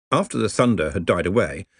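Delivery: usual speaking voice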